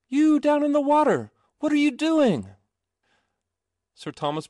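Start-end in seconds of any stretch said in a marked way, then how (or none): 0.1-2.5 very high, loud call. A narrator's reading. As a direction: shout down to the water. Boy calling down.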